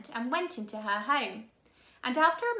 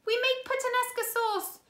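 desperate cry; 13 semitones higher than usual. A narrator's reading. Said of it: "Violet cried".

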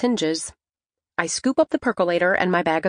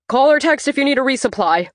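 high, loud call across the shop; shouting after someone; 7 semitones higher, 6 dB louder, faster than usual. Acted. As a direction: call out after her as she leaves. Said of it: "the assistant manager shouts after me".

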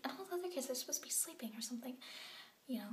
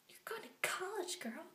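a higher, sassy take on the matron; mock her with a sassy twist; 6 semitones higher than usual. Retelling the matron's news in a high sassy mock voice. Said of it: "she doesn't talk like that at all".

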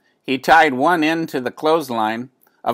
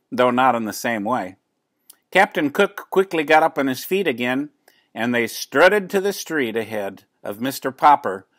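plain reading voice

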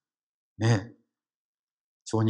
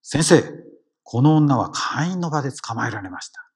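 raised voice, calling out to the teacher; loud accusation; 3 semitones higher, 7 dB louder than usual. Retold or imitated. Scribes loudly confront the teacher before the crowd, raised voice. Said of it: "そこで言った".

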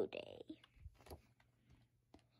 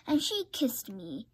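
ordinary delivery, nothing marked

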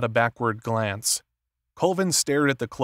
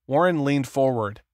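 ordinary delivery, nothing marked

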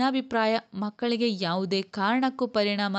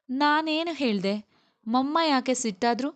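put-on higher-pitched childlike wondering; puzzled child; 6 semitones higher than usual. A narrator's reading. Child wondering why Mummy got angry, puzzled and high. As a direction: voice a bewildered little kid, pitched up.

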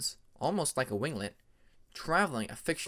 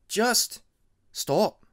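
high, loud insistence; firm loud stop; 8 semitones higher, 7 dB louder than usual. Acted. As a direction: shut him down firmly.

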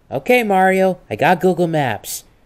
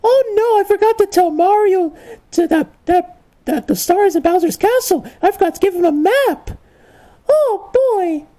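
much higher flustered princess voice; Princess Peach. Retold or imitated. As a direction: squeak it out like a frantic princess. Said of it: "And she told me".

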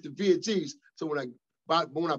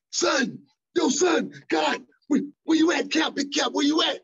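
much higher, louder excited yelling imitation; imitated hollering; 14 semitones higher, 6 dB louder than usual. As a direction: holler like a frantic friend on the phone.

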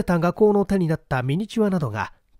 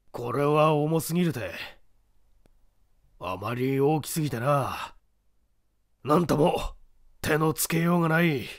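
sighing regret; 3 dB quieter than usual. Acted. Lupin sighs over treasures.

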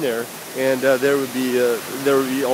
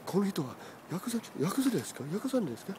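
higher, soft, sing-song mock question; teasing hushed greeting; 7 semitones higher, 9 dB quieter, faster than usual. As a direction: tease the friend with a quiet, sly ask. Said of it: "I'm trying to say".

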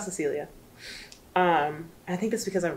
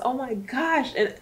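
ordinary talk

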